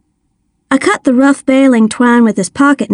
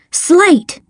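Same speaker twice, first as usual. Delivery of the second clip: sharp higher cry of the name; cried out; 7 semitones higher than usual. A narrator's reading. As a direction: blurt the name as the phone rings. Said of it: "I cried".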